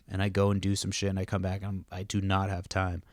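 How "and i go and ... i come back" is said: ordinary talk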